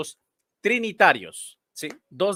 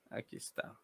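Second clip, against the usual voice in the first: whisper; 19 dB quieter than usual. Real, not acted. Muttering to himself while searching.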